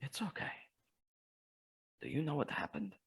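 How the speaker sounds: soft comfort. Acted. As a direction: speak gently to a crying friend. Soothing sobbing friend softly.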